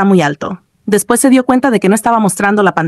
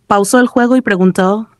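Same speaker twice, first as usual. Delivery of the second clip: ordinary delivery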